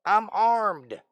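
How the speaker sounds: loud, high put-on warning; mock warning shout. Warning off the unknown boat, loud mock threat. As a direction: call it out as a playful threat.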